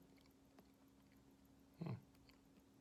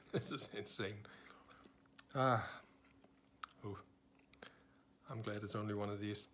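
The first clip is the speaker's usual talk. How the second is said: ordinary talk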